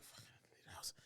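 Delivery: whispered annoyed curse; whispered irritated aside; whispered grumble. Retold or imitated. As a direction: hiss it under your breath. Muttered annoyance.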